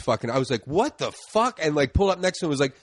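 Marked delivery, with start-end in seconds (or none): 0.7-1.6 higher-pitched indignant outburst; indignant, pitch jumping up; retold outrage. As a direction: act out a road-rage reaction. Retold road-rage outburst.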